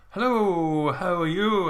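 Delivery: imitating a Scottish girl greeting guests; Scottish accent. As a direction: put on a cheery bad Scottish accent. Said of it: "that was supposed to be a Scottish accent".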